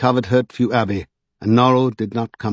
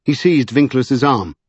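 plain reading voice

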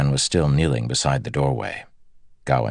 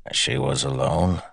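gravelly voice. A narrator's reading. Sleet questions the guard, gravelly. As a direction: ask it with gravel in the voice. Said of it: "asked the man in his gravelly voice".

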